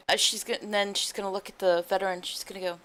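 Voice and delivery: ordinary delivery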